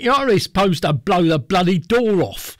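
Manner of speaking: put-on Cockney accent. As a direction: toss it off in broad Cockney, half joking. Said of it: "Do you want me to say it in a Cockney accent".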